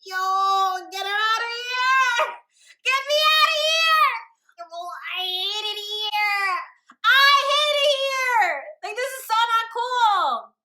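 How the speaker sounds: high, wailing full-voice yell; hammed-up despairing shout; inner meltdown. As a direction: scream it out like dying inside. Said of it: "I'm literally dying on the inside".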